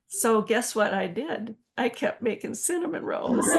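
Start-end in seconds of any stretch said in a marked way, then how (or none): none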